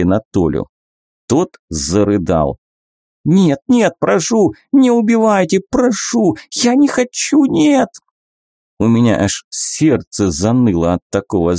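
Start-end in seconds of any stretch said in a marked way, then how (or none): 3.2-7.9 high panicked begging, rising steadily; desperate pleading. Acted. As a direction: beg for your life, voice climbing. Infected boy begging for life.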